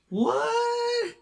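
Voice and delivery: very high, strained yelp; squeaky bewilderment. Acted. Pinned driver to the cyclist, squeaky bafflement. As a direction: squeal it in pained disbelief.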